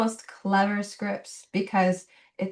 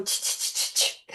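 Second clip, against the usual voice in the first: voiceless monkey "ch-ch-ch" imitation; evenly spaced chittering noises; monkey chatter. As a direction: chatter back like cheeky monkeys, no voice. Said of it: "And the monkeys go".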